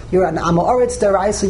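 normal talking voice

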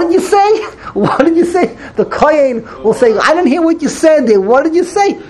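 high, loud, insistent questioning; demanding to hear. Acted. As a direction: call out as if you couldn't catch a word. Kohen who cannot hear the mumbler.

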